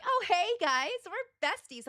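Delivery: high, loud mock-friendly greeting; gushy bestie imitation. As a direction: gush like an uninvited best friend. Imitates intrusive overfriendly game character.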